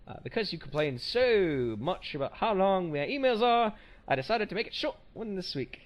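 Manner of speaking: a deliberately bad Aussie voice reading the email; reading a fan's email in a bad Australian accent; terrible Australian accent. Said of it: "I'm doing a terrible Australian accent".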